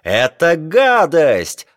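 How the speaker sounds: high, drawn-out outraged cry; indignant yell. A narrator's reading. Geographer red with indignation at the captain, yelling. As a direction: yell it slowly with disgust. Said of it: "орал Леруа".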